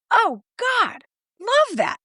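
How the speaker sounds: high, sarcastic delight; mock delight. Coach to client who already sent text, high mock praise. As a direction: gush ironically up high.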